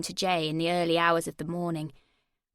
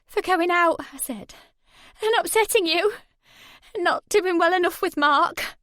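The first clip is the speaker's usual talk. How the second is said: much higher, louder, quavering list; trembling voice; 9 semitones higher, 5 dB louder than usual. Acted. Woman listing her apologies to Jay, trembling. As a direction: force the words out through terror. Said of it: "My voice trembled".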